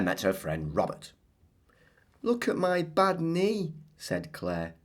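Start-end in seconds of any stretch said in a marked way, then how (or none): 2.2-3.7 put-on high childlike girl voice; Claire's voice. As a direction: voice little Claire showing off her knee.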